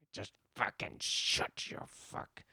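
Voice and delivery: whispered, slow angry cursing; angry muttering. Acted. Man with anger issues walking around, angry muttering. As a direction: curse under your breath, slowly and harshly. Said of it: "like anger issues because you just walk around".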